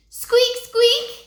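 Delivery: high, loud squeaky mouse noise; shrill little squeaks. Acted. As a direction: squeak like a tiny pet mouse.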